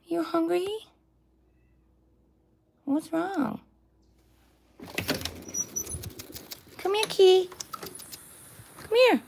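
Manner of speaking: high coaxing voice for a cat; pet talk. Acted. Talking to a stray cat at her door, high coaxing pet voice.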